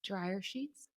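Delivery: meek, hushed question; very quiet. Retold or imitated. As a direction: say it very softly, on the defensive. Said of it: "very quietly".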